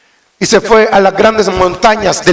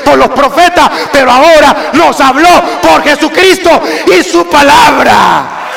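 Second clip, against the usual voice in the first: fiery preaching; 7 semitones higher than usual. Acted. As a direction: thunder it from the pulpit. Preaching at full force.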